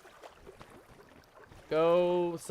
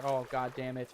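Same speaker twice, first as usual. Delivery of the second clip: ordinary delivery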